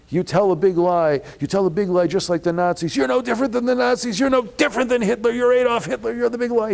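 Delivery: very high, loud furious screaming; escalating rant. Retold or imitated. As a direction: rage at him like a man losing control. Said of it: "he escalates so that by".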